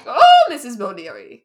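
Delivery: very loud mock-Irish holler; over-the-top Irish call, big drawn "Oh"; bad Irish accent. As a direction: holler the name in a terrible fake Irish accent. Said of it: "in a bad Irish accent".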